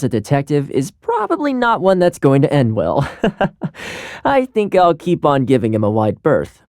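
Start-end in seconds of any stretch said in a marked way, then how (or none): 3.1-4.2 soft, brief laugh; wry chuckle. Acted. Joking about a troublesome detective, soft wry chuckle. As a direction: chuckle quietly to yourself.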